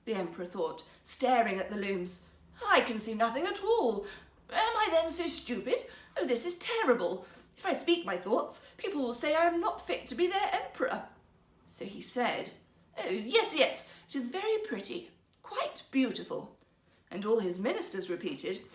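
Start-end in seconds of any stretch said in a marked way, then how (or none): 2.7-11.1 higher, louder, rising alarm; panicking emperor. Acted. Emperor at the empty looms, thinking in rising panic. 13.0-16.6 higher, forced agreement fading out; bluffing emperor. Acted. Emperor pretending to admire the cloth, forced and fading.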